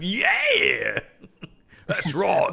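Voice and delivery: a put-on Ingolstadt accent, laughing; mock Ingolstadt accent. Mocking the police captain, laughing Ingolstadt accent. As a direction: lean into a joke Ingolstadt accent. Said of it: "classic dulcet tones of the Ingolstadt accent".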